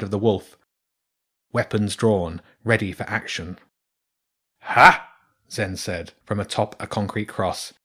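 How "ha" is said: loud mocking laugh-shout; triumphant ha. A narrator's reading. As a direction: bark out a gloating ha. Zen triumphantly appears.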